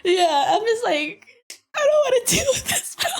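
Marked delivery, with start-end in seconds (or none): 1.7-3.1 very high, squeaky mock-sobbing complaint; laughing whine. Worker fed up in the last semester, laughing mock whine. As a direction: whine it through laughter, hammed-up.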